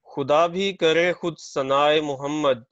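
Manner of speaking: measured, projected verse delivery; poetry recitation. Real, not acted. Poet reciting a naat at a mushaira, poetry recitation. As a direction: recite the couplet out to an audience.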